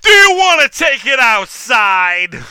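loud, high threatening yell; aggressive challenge shout. Acted. Rowdy customer loudly challenging cosplayer to fight.

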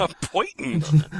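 slow careful "A poitin?" with a rising ask. Shown as a demonstration. Friend correcting the word, slowly sounding out "poitin". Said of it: "What the fuck is that word?".

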